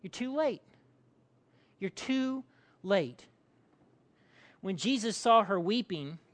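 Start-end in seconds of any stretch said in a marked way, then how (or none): 0.0-0.6 higher-pitched mild accusation; accusing. Acted. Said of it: "There's the accusation". 1.8-3.2 slow, with a pause before LATE; drawn-out, measured reproach; slow accusation. Acted. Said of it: "There's the accusation".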